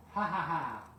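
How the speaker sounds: playful engine's mocking laughter; cheeky laugh. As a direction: laugh at Gordon for falling for the joke. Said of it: "laughed Percy".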